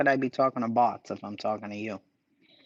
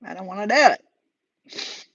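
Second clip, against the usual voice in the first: laughing; 10 semitones higher, 9 dB louder, slower than usual. Real, not acted. Laughing at his own reluctance.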